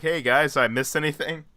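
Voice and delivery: lightly acted holiday-happy hero; carefree Hawkeye. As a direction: stroll in cheerfully oblivious. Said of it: "Hawkeye just comes home from vacation".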